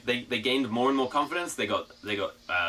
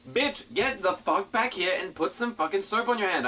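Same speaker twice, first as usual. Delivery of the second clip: mock exasperated yell; 7 semitones higher than usual. Retold yelling at kid.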